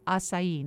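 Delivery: acai said ah-SIGH-ee with the last I sounded; firm correction, acai as ah-SIGH-ee; correct pronunciation. Shown as a demonstration. Host correcting the listener, acai ah-SIGH-ee.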